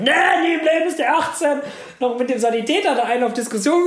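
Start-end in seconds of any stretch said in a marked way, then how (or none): 0.0-1.6 indignant protest. Retold or imitated. Arguing with the paramedic over age.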